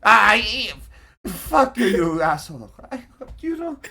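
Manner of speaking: put-on bratty child. GM voicing the captive child cursing his gruff captor. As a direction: spit defiance like a cocky little kid.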